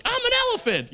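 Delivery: very high, playful squeal of words; silly boast. Acted. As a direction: announce it way up high, like a kid playing. Playful boast while eating peanuts.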